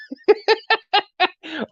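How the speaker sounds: high delighted shriek-laugh; excited droid squeal. Acted. The droid is excited to be next to sabers, high squeal. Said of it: "I scream like that".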